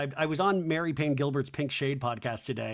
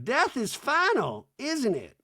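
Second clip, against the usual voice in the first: high, slow, ominous [name]; mock [name], grave and high; hammed-up slow high [name]; [name] impression; 10 semitones higher, slower than usual. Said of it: "And [name]'s like".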